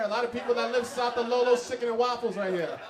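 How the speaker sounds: ordinary delivery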